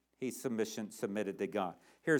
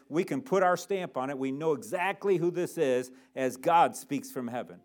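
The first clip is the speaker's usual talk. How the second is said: ordinary delivery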